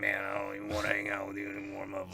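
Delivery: low, soft, quick put-on voice; grumpy brush-off. Comic playing the buddy who refuses to hang out, low grumpy voice. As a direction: drop it low and rattle off the refusal. The answering character, voice dropped.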